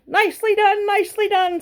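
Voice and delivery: a goose praising a narrow escape; goose cheering. A narrator's reading. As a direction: cheer the pig on like a proud goose. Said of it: "said the goose".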